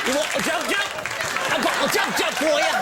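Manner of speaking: lightly hammed-up English gent; mock British accent. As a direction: offer it like a silly Brit. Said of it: "In England, we call these".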